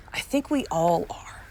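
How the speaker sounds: a whispered, unvoiced aside to herself. Real, not acted. Host whispers aside to listeners, then repeats it aloud. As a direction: whisper it as a private thought.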